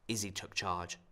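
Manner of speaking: ordinary delivery, nothing marked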